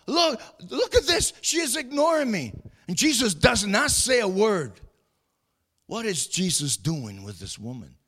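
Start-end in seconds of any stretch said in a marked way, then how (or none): 0.1-2.5 high, indignant complaint; mock indignation. Preacher acts out complaint about being ignored, indignant. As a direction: protest it in a much higher pitch.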